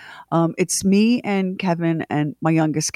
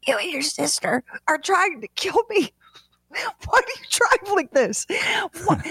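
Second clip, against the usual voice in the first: high, strained, out-of-air scolding; breathless after choking; 8 semitones higher, 3 dB quieter than usual. Retold or imitated. Mother scolding daughter right after choking, acted breathless voice. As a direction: squeeze the words out high between gasps. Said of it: "get some energy to form some words".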